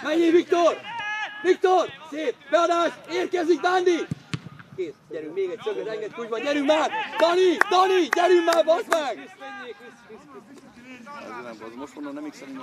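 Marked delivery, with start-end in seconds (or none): 0.0-4.1 loud, high calls in bursts; sideline shouting. Real, not acted. Spectator calling the cross to Viktor, shouting. 6.4-9.4 high, loud insistent yelling; urging shout. Real, not acted. Spectator urging Dani on with curses, shouting.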